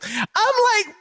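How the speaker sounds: ordinary talk, nothing marked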